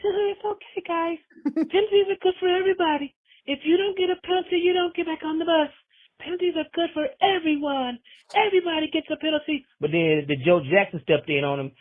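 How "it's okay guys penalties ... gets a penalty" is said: much higher breathy-free imitation, light and airy. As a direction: coo like a gentle pop star letting everything slide. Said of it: "I was calling them [name], [name]".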